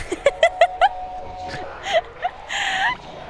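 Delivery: high-pitched laughing fit; loud high laugh. Real, not acted. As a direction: burst of squealing laughter. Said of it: "I'm making him German".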